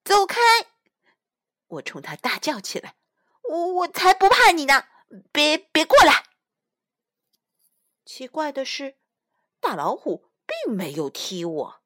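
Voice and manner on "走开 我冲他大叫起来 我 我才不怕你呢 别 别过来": higher, louder stammering yell; scared shouting. A narrator's reading. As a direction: shout bravely while terrified.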